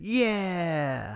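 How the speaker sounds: long high cheer falling away; mock celebration. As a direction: imitate a kid cheering his score, drawn out. Retold student celebrates his test score.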